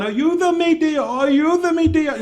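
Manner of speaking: high, drawn-out wail for help; mock Spanish screaming. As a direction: wail it in Spanish, imitating a desperate plea. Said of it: "She starts screaming in Spanish".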